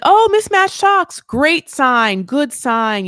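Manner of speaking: high, loud and slow delighted exclaim; mother cheering the mismatched socks, high and loud; delighted mom. Retold or imitated. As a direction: ham up a thrilled mother who loves the socks. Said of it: "she's like".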